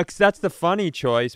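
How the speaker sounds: usual speaking voice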